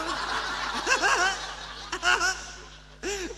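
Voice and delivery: high, loud drawn-out laughter; long laugh. Real, not acted. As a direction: keep laughing at the story. Laughs at own story.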